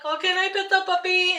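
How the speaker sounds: high, eager ask; excited asking. Retold or imitated. Asking the lady to pet the puppy, high eager plea. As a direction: squeal the question sweetly. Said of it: "I'm like".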